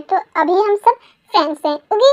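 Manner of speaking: normal talking voice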